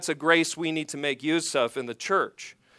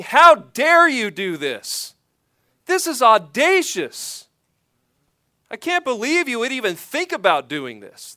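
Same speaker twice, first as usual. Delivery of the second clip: outraged rebuke; 5 semitones higher, 4 dB louder than usual. Retold or imitated. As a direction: hurl it as Paul's indignant "how dare you". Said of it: "Paul is saying".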